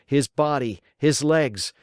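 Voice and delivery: ordinary delivery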